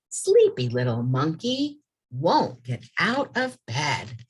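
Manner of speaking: lively rhyming read-aloud. A narrator's reading. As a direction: build into a playful pep chant.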